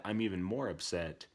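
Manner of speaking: ordinary delivery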